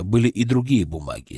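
usual speaking voice

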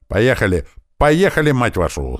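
high, loud hurry-up shout; yelled order. A narrator's reading. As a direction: shout to get everyone moving. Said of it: "заорал Грач". (громкий командный крик)

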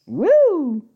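a very high, loud whoop; excited cheer. Real, not acted. Echoing the cheer.

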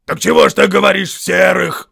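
loud, high indignant troll. A narrator's reading. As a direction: demand an explanation, outraged. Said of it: "возмутился Берт".

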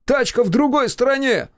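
annoyed big brother calling out; exasperated shouting. A narrator's reading. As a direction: shout after him in frustration. Shouting after walking brother.

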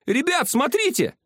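high call to the group. A narrator's reading. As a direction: call the others over, high. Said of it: "вдруг позвал Рыжий". (громкий оклик высоким голосом)

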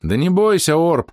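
high reassuring shout. A narrator's reading. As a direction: call out across the field. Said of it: "крикнул я".

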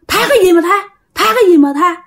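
high-pitched scornful retort; indignant spirit. Acted. Spirit refuses the grandfather's plea, high indignant retort. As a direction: throw the plea back, pitched well up.

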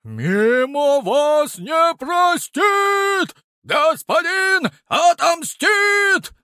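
high, slow, loud wailing chant; wailing creature cry. Acted. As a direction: wail it out like a stone pet. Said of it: "голосил Мимо".

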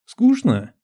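sharp high jump in disbelief. A narrator's reading. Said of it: "Голос женщины стал ещё громче".

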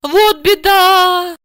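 high, long-drawn lament; woeful. A narrator's reading. Housewife disappointed by useless information, woeful. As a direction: wail it slowly in disappointment. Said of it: "пригорюнилась Ася".

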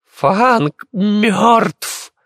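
high, drawn-out screams of grief; old woman's hysterical high shrieking; screaming herself hoarse. A narrator's reading. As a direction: wail the news over and over, very high. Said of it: "продолжала кричать до хрипоты".

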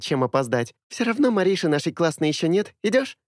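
high, rapid happy chatter; bubbly girl rattling on; too much caffeine. A narrator's reading. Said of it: "Миля радостно затараторила". (радостная тараторка)

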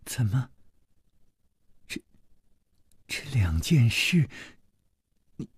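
nervous faltering. A narrator's reading. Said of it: "紧紧地捏着自己的衣襟儿".

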